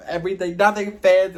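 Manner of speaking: high, loud mock outfit panic; exasperated retelling. Storyteller replays fretting over what to wear before a date, exasperated.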